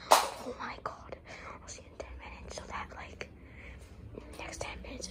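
hushed whisper near target. Real, not acted. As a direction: secret prankster whisper. Whispering behind sister.

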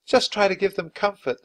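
a hint of a busy nurse giving orders; young nurse. A narrator's reading. Nurse character voiced.

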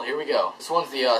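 ordinary talk, nothing marked

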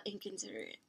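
Inconsiderate whispered, barely audible; whispered repeat. Real, not acted. Reviewer muttering her verdict again, whispered. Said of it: "That is inconsiderate A F".

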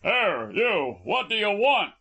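shouting out, pitched well up; shouted hail. A narrator's reading. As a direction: hail a stranger's boat suspiciously. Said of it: "he shouted".

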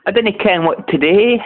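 high, lilting Scottish mock; mock Scottish accent. Gamer suggesting a Scottish taunt, mock Scottish accent. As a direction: put on a playful Scottish brogue. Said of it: "He could have put on a Scottish accent".

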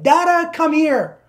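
high, loud, drawn-out call for Dad; mock demanding summons; imitated child calling. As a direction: call him over like an impatient kid. Imitating daughter summoning her dad.

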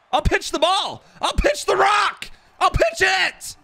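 high, loud, drawn-out hype yell; hype shout. Real, not acted. Streamer hyping chat for a late stream, excited shout. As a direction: pump up the chat like an announcer.

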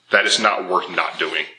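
ordinary delivery

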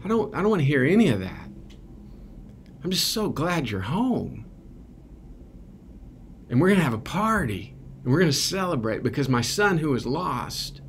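high, quick, overjoyed father brushing off the apology; joyful father. Retold or imitated. Father to returning son, high, fast and overjoyed. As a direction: cut him off, you're just so happy he's home. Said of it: "Dad's like".

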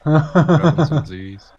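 slow, loud line through laughter; laughing. Real, not acted. Joking with his co-op partner, laughing loudly. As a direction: laugh the pun out slowly.

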